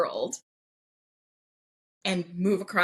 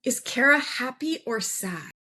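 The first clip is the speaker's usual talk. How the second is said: slow, higher-pitched puzzled worry; mock worried dad; 5 semitones higher than usual. Baffled dad asks mom about the message, worried. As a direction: imitate a worried, bewildered dad. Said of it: "looks at my mom real worried".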